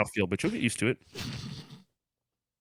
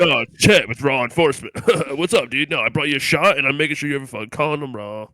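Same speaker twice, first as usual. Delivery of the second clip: loud, fast bro cop; loud party cop checking on a frat guy; hyped bro cop; 7 dB louder than usual. Acted. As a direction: hype him up like a party bro. Continues cop character bit.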